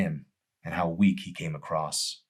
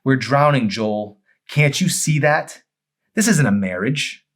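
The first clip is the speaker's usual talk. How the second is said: high and loud, pressing the point; anguished wife; 8 semitones higher, 9 dB louder than usual. Acted. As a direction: make him see they're drowning. Amy confronting Joel.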